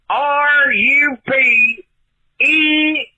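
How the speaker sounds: R U P E R T spelled letter by letter, very slowly; spelling out slowly. Real, not acted. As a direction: spell the name out slow and clear. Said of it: "you got my name wrong".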